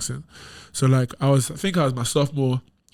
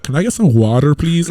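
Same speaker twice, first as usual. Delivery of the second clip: mock American accent; 2 semitones higher, 6 dB louder than usual. Mocking how teammates order water, louder American accent. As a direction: imitate Americans asking for water. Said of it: "you be like".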